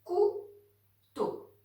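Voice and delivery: COU-TEAU split into two flat robot beats; robot syllables. Shown as a demonstration. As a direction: say Couteau like a robot, each syllable apart. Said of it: "on parle comme un robot". (Couteau en voix de robot, syllabe par syllabe)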